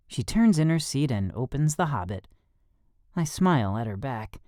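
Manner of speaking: usual speaking voice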